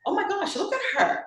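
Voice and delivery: high, amused judging exclamation; mock gossiping gasp. Recalling her old judging self, high amused gasp. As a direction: squeal it like a nosy onlooker. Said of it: "'Cause I was once that".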